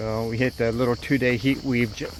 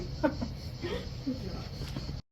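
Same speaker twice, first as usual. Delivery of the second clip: breathy laugh; 13 semitones higher, 14 dB quieter than usual. Real, not acted. Teasing about her agility.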